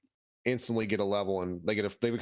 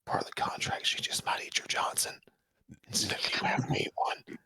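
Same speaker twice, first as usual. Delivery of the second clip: whispered secret aside; conspiratorial whisper; 3 dB quieter than usual. Acted. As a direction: lean in and whisper the warning. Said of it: "He's like".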